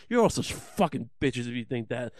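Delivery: ordinary delivery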